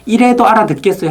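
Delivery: 높은 음으로 으스대듯 묻기; 듣는 사람을 떠보듯 거만하게; 잘난 체하는 발표자. Retold or imitated. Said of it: "정말 그 사람은". (거만하게 떠보는 말투)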